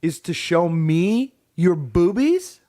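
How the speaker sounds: usual speaking voice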